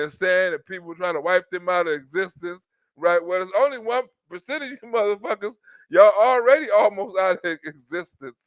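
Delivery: ordinary delivery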